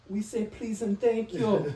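high, slow, loud Jamaican lady's scolding; Jamaican lady impression. Jamaican coworker correcting a yelling cook, mock scolding. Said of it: "this Jamaican lady would just be like".

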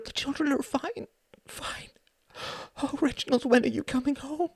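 high, tearful, almost whispered. Acted. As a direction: plead through tears to come home. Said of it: "she was crying".

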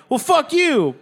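high-pitched mock comeback; acted-out argument; indignant retort. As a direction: fire back indignantly, voice jumping up. Acts out an escalating quarrel.

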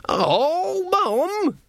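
very high, embarrassed whine; whiny protest. Acted. Son protests to his mum, very high and embarrassed. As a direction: groan it like a mortified teen.

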